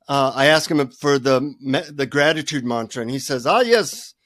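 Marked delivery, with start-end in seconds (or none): 3.5-4.1 put-on guru's Indian accent, pitched up. Guru greeting a visitor who asks for the mantra, Indian accent. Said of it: "in his Indian accent".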